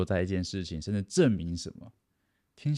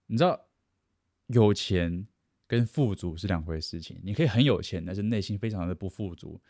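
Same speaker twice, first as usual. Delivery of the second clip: normal talking voice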